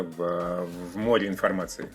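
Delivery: ordinary talk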